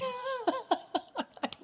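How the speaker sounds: a high, delighted laugh; high burst of laughter. Real, not acted. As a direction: let the laugh ring out high. Laughing at Joanne's champagne.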